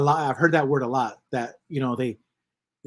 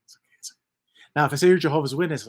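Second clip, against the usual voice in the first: ordinary talk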